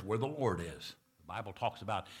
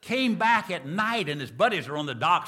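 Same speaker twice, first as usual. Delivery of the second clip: louder storytelling voice, building excitement; 6 semitones higher, 8 dB louder than usual. Acted. Preacher telling a joke story, louder and excited. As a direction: lean into the scene, voice rising.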